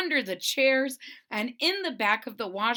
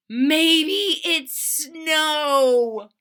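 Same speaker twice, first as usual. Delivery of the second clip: slow, high, wondering little bear; drawn-out, higher teddy bear voice, amazed; Corduroy's voice; 6 semitones higher, 8 dB louder, much slower than usual. Acted.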